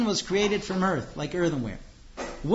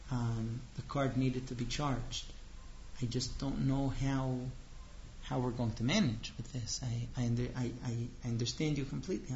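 quiet, hesitant, saddened husband stammering; sad husband; 5 semitones lower, 6 dB quieter than usual. Acted. Husband quietly sad to wife about the charged card. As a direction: sound let down, not angry. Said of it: "If he were sad".

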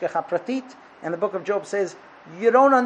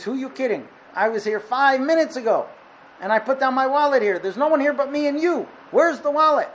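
raised, higher-pitched indignant accusing; angry accusation; 6 semitones higher, 4 dB louder than usual. Acted. Mister Blue accusing Mister Brown of taking his wallet, heated. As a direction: push back hard, getting more heated toward the end.

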